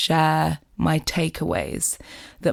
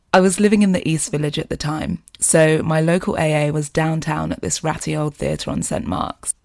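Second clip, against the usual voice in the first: ordinary delivery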